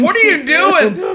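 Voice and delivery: high, loud shocked yell; mock wife shout. Wife walks in on the shower attempt, mock yell. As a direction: catch him in the act, shouting.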